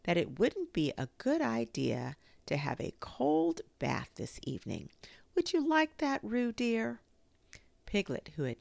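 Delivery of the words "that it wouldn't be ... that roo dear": musing aloud, rising to a high coaxing question; ponder, then sweetly ask the child; mother mulling it over, ending high; thoughtful. A narrator's reading. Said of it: "in a thoughtful voice".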